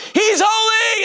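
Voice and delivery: very high shouted proclamation; high-pitched outburst of praise; ecstatic cry. Acted. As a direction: cry it out in acted rapture. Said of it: "four living creatures go".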